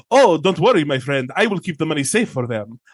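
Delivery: put-on smooth-talking Middle Eastern captain accent; playful Middle Eastern accent, soothing patter. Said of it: "I'm allowed to do that accent".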